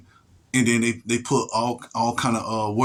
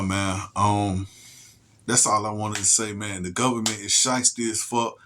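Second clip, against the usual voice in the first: ordinary talk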